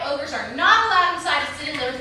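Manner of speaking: ordinary talk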